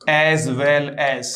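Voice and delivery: as well as drawn out very slowly; slow, careful model reading of "as well as"; slow demo. Shown as a demonstration. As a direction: stretch each word of "as well as" slowly. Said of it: "एक शब्द है".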